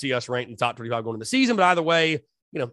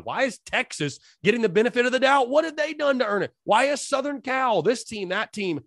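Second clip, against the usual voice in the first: higher-pitched mimicking of griping fans; incredulous complaint; 8 semitones higher than usual. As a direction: fire off mock-indignant questions, pitched up. Mimics fans questioning the rankings.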